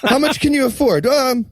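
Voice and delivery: high, accommodating voice; hesitant kidnapper. Acted. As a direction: ask sheepishly, as if not to impose. Acts timid kidnapper haggling over ransom.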